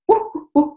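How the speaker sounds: high, loud "whoop" noises; imitated whirling whoops. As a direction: whoop like energy spinning wildly. Imitating fast-spinning spiritual energy.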